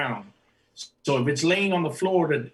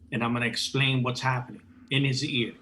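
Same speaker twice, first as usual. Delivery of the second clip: ordinary talk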